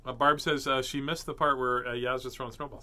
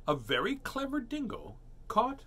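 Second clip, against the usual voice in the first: put-on lively, lilting read to children; high, swooping storyteller pitch; storybook reading; 6 semitones higher than usual. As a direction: open the tale with relish. Reads picture book aloud.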